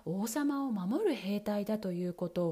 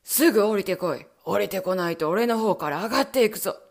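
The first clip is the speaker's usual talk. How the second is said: low, loud villain's bellow; Bluebeard roaring; 3 semitones lower, 10 dB louder than usual. Acted. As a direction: roar it up the stairs with menace. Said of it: "ありったけの声を出して怒鳴り立てました".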